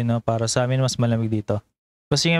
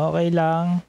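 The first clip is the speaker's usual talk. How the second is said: mimicking the owner; 4 semitones higher than usual. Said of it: "Sabi niya".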